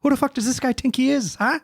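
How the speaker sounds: put-on high-pitched, cocky Irish accent; comic Irish brogue, challenging; Conor McGregor. Host demonstrating his impression to co-hosts, high challenging Irish accent. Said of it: "Conor McGregor".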